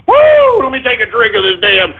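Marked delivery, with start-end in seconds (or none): 0.1-0.6 loud high-pitched woo; high whoop. Real, not acted. Streamer celebrating angry trolls with a loud whoop. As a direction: let out a triumphant whoop.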